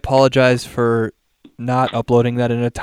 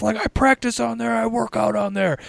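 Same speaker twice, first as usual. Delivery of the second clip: mock angry rant; 10 semitones higher than usual. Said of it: "he fucking goes nuts".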